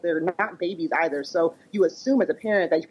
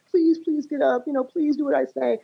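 higher, pleading please please; 9 semitones higher than usual. Retold or imitated. Mother begging her child out of bed, higher pleading. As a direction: beg the child to get up, pitched up. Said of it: "But I was like".

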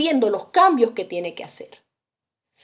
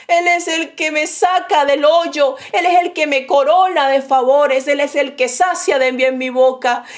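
fervent psalm recitation; 4 semitones higher, 5 dB louder than usual. Acted. Preacher declaiming a psalm to the church, loud and fervent. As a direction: proclaim the psalm with full fire.

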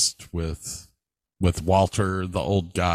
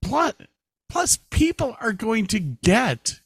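teasing sing-song; 12 semitones higher than usual. Acted. Playful sing-song teaser.

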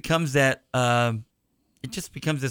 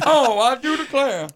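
slow, much higher, louder Southern drawl; Southern colonel; 11 semitones higher, 4 dB louder than usual. Pretend. Host keeps the bit going, slow hammed-up Southern accent. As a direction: savour it like a grand old Southern gentleman. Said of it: "he's turned into a Southern colonel".

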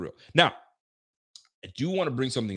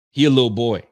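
mocking quote; 9 dB louder than usual. Mocking repeat of player's quote.

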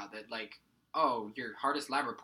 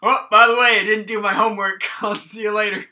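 much higher and much louder, laughing through it; mock breezy student; 9 semitones higher, 20 dB louder than usual. Student breezily telling the teacher after rear-ending him, laughing. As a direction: cheerfully confess to the teacher after the crash. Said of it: "And you're like".

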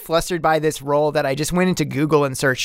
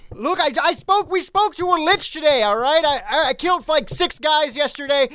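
high earnest pleading; 8 semitones higher than usual. Acted. Dak reasoning with runaways.

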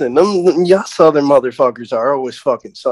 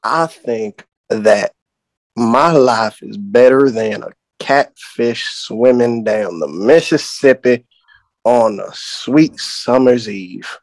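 slow, drawn-out Southern accent; unhurried, lower Southern storyteller drawl; 4 semitones lower, slower than usual. Shown as a demonstration. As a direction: stretch every phrase in a lazy Southern drawl. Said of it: "my southern drawl, we can definitely do that".

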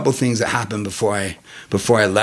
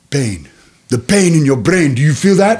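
put-on African accent; 3 semitones higher, 7 dB louder than usual. African inmate teaching his chess partner, African accent. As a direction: press the question in a mimicked African accent. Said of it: "he talked like this".

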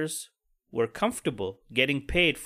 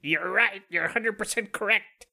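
higher, fawning yes-boss voice; mock yes-man; 6 semitones higher than usual. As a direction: suck up to the boss, mockingly. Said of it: "you gotta take it".

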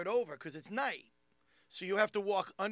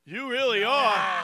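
imitating the bar guy; 4 semitones higher, 10 dB louder, slower than usual. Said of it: "he goes".

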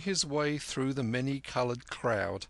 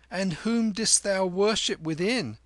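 put-on friendly, bright young boy's question, pitched up; affable boy Kim; 9 semitones higher, 5 dB louder than usual. A narrator's reading. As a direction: ask kindly like a cheeky street boy. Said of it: "said Kim affably".

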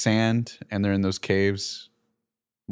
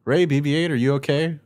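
higher, lightly imitated calling out; mock yell; 6 semitones higher than usual. Poe checking on fallen friends, mild mock yell. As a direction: call to them with half-hearted urgency. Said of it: "Poe yells out".

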